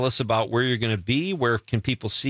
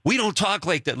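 higher-pitched mock scolding; chastising; 7 semitones higher than usual. Filmmaker retelling how locals chastised him, mock chastising. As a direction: imitate indignant locals telling you off. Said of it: "like, chastised me".

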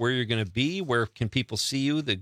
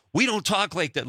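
higher-pitched mock indignation; chastising retort; 7 semitones higher than usual. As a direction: imitate an offended local scolding. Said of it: "like, chastised me".

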